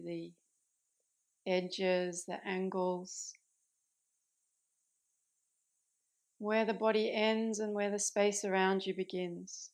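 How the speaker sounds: calm meditation guide with long pauses between phrases; unhurried, settled voice, easing listeners into rest; guided meditation. Acted. Yoga teacher guiding listeners into Yoga Nidra, calm guided meditation.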